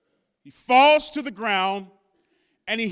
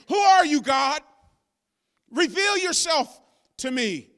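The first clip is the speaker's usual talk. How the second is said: Paul crying out; 3 semitones higher than usual. Acted. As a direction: cry out to God for an answer. Preacher voices Paul crying out.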